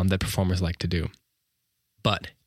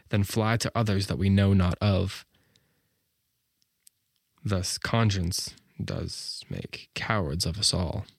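Shakespeare recitation. Shown as a demonstration. Teacher reading Hamlet's soliloquy aloud, measured recitation. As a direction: let the pace of the language carry you. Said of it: "So I'm gonna read it again".

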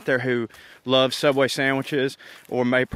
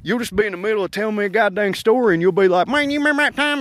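[name]'s impression of me; 7 semitones higher, faster than usual. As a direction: squeak it out like a teasing buddy. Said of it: "that's his impression of me".

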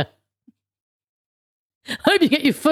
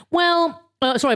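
normal talking voice

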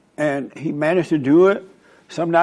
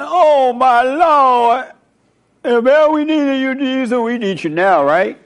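high, loud panicked pleading; panicked disciples; 9 semitones higher, 4 dB louder than usual. Retold or imitated. Disciples in the storm calling on Jesus, high panicked plea. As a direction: beg Jesus for help as the storm hits. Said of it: "They were like".

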